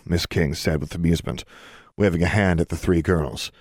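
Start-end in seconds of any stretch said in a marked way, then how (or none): none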